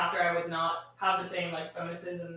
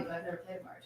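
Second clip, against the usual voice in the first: breathy whisper, much softer; whispered aside; 2 semitones lower, 12 dB quieter, much faster than usual. Real, not acted. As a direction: drop to a whisper. Quiet aside confession.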